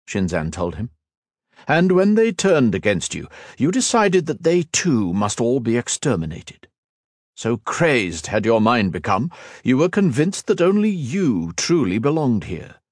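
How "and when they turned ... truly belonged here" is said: sneering villain. Acted. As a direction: savour every cold word. Shinzan accuses Ethan.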